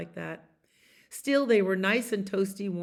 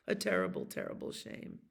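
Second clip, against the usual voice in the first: mock sad; 12 semitones lower, 6 dB quieter, faster than usual. Mouse mourns the mitten.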